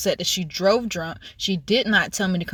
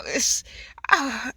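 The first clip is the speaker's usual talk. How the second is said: ordinary delivery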